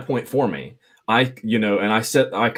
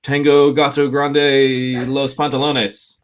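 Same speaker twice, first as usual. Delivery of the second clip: slow, exaggerated gringo Spanish, a bit louder; mock broken Spanish; 4 semitones higher, 4 dB louder, much slower than usual. Mocking a bluffing Spanish speaker, slow exaggerated gringo accent. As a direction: ham up fake Spanish word salad, drawn out. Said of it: "And they're like".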